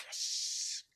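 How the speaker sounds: breathy hushed yes of quiet delight; a secret little cheer under the breath; whispered yes. Retold or imitated. Watching German protests, he whispers his approval.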